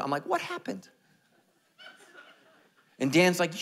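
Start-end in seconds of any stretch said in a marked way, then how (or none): 0.3-0.9 very high, squeaky-pitched question; mock disbelief. Pastor back from mission trip, mock disbelief. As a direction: ask in comic high-pitched shock. Acted shock on returning from trip.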